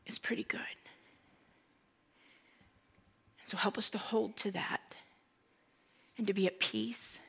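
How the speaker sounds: hushed prayer with long pauses. Acted. Preacher asking the Lord to help the congregation, quiet and prayerful. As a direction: pray earnestly, phrase by phrase.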